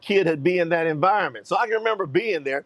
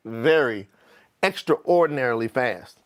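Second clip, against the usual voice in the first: plain reading voice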